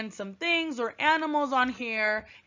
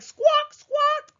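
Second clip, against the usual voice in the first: loud squawks, much higher; chicken squawk; 7 dB louder than usual. Shown as a demonstration. Teacher imitates chickens running around squawking, high and loud. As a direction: squawk like a flustered hen. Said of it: "they're like".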